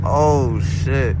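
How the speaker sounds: ordinary delivery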